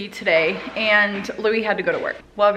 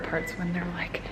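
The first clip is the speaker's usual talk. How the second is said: whispering; 2 semitones lower, 7 dB quieter than usual. Real, not acted. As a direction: keep it hushed and fond. Kids just waking, mom whispers.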